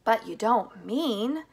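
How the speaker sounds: slow, loud protest; alarmed shout. A narrator's reading. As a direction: shout in disbelief, stretching the words. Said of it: "shouted Grandpa Joe".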